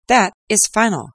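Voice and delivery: final verdict. Acted. Lestrade closing the case, emphatic mild triumph. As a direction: slam it down as Lestrade. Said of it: "said Lestrade".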